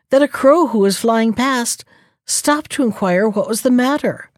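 plain reading voice

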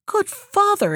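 high, astonished call to the father; exclaiming. A narrator's reading. Youth to the hermit at an amazing sight, exclaiming. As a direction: exclaim with pitch leaping up. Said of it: "exclaimed the youth".

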